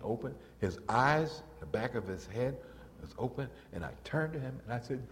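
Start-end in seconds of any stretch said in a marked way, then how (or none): none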